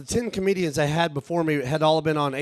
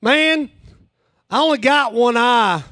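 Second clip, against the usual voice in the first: loud, high voice of the little dude; imitating one-eyed heckler; 6 semitones higher, 5 dB louder than usual. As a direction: call it out high and loud, mimicking an aggrieved bar patron. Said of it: "The guy gets back to his chair, turns around and goes".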